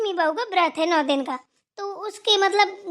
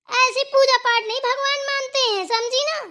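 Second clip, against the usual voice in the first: higher, heated telling-off; raised-voice scolding; 4 semitones higher than usual. Acted. Sister-in-law faults elder's worship, raised-voice scolding. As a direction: scold her with a raised pitch. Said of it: "ऊंची आवाज़ में बात नहीं की जाती है".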